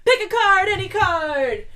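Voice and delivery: loud announcer call of the catchphrase; catchphrase shout. Real, not acted. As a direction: belt it like a game-show host. Announcing the show's game segment.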